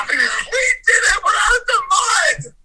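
high, loud laugh-crying shout; overjoyed yell that they made it; triumphant laughing cry. Real, not acted. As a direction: celebrate through tears and laughter.

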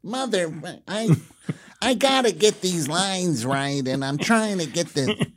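high, strained mock frustration; actor's regular voice, mad. Actor in Colonel Sanders costume messing up takes, mock exasperated. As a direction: imitate an actor cursing his own flubbed lines. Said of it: "He would start talking his regular voice".

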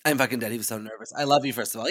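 normal talking voice

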